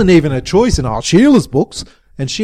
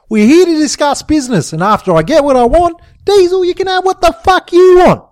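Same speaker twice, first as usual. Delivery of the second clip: high, loud, exasperated tirade; yelling; 11 semitones higher, 5 dB louder than usual. A narrator's reading. Jimbo halts the fight to discuss business, yelling. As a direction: raise pitch and volume to take control. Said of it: "when fucking Jimbo yelled".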